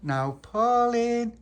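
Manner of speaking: high, loud, drawn-out call. Acted. He knocks at Pauline's and calls her out. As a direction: stretch the name out loudly at the door.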